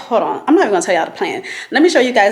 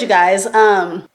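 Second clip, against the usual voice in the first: ordinary talk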